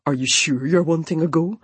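mildly patronising, half concerned; a hint of a patronising ranger; condescending ranger. A narrator's reading. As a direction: talk down to her gently. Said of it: "His tone part condescending, part concerned".

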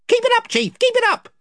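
very high squeaky egging on; other voices. Acted. Followers urging their chief on, very high. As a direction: cheer the chief on in a tiny voice. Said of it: "said the other voices".

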